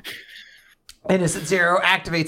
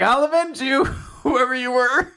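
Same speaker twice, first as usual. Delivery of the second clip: high, laughing hammed-up vow; mock battle cry; 9 semitones higher than usual. Mash vowing vengeance for a stranger, laughing. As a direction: vow revenge through a laugh. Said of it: "Matt's just like".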